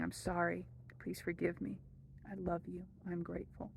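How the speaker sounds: softly spoken prayer that fades into a whisper by the last line; prayer fading to a whisper. Shown as a demonstration. Teacher guiding viewers through the prayer, fading to a whisper. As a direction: let the prayer sink gently into a breathless whisper.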